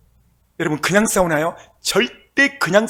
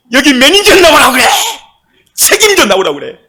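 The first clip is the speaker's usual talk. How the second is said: much higher, much louder furious yell; shouting demand; 10 semitones higher, 13 dB louder than usual. Acted. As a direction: demand the manager at the top of your voice. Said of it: "막 접수이 큰 소리칩니다". (접수처에서 매니저를 부르며 크게 소리치기)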